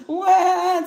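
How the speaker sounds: high cartoon wah, loud and whiny; mock cat wail. Imitating the banana cat crying, high mock wail. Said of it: "starts crying".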